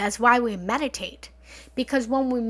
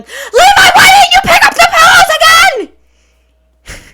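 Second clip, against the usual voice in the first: furious high-pitched yell, much louder; angry yell at partner; 22 dB louder than usual. Retold or imitated. Yelling at her partner Levi about the pillows, furious. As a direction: blow up at him over the pillows. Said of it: "I got a little loud and scared you guys".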